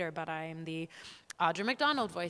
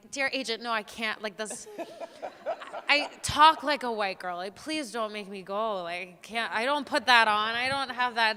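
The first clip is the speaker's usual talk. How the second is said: pleading with agent; 4 semitones higher, 7 dB louder than usual. Retold or imitated. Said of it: "I was like".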